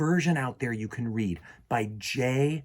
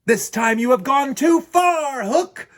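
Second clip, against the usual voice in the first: higher, louder challenge; heroic cry; 9 semitones higher, 7 dB louder than usual. Acted. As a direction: call out the villain boldly. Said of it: "Peter cried".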